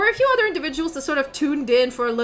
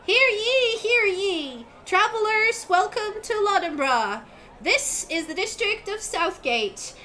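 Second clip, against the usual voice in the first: high, bright-voiced young crier calling out to travelers; eager town crier kid; 7 semitones higher than usual. GM as the kid crier announcing inns to travelers, high and proclaiming. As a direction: hawk the inns like a paid teenage crier.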